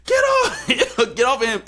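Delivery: very high, crying-out protest; frantic yelling wife. Retold or imitated. Fighter yelling to defend her husband, very high and frantic. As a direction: shriek to get them off him. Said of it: "Franchon's like".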